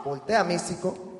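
usual speaking voice

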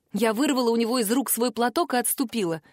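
plain reading voice

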